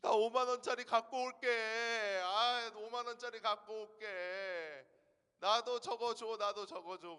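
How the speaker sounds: the eldest's tearful loud demand; crying child. Retold or imitated. Eldest child wailing to dad for money, crying loudly. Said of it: "그랬더니 첫째 막 울어요".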